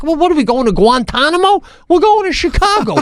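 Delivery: sarcastic high-pitched jab at the crew; mocking outburst. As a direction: scoff at them in a high, put-on voice. Mocking the flight attendants' head count.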